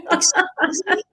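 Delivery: high, disbelieving excuse me; incredulous excuse me. Retold or imitated. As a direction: act out being stunned. Retold disbelief at friend.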